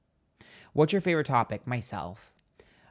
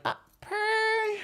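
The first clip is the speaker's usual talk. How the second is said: very high, drawn-out squeal; playful approval. Retold or imitated. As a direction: sing out a pleased, high-pitched purr. Playful acted reaction to the verdict.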